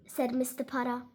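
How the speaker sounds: ordinary delivery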